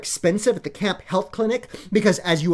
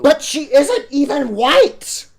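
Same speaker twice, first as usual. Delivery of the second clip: high, loud, slow indignant outburst; outraged protest; 7 semitones higher, 7 dB louder, slower than usual. Acted. Spoiled daughter protesting to Daddy, slow high outrage. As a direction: draw it out in shocked outrage.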